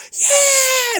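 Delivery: high cheering crowd yell; kids cheering. Retold or imitated. Imitating the crowd of kids cheering, high. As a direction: shout like a room of kids.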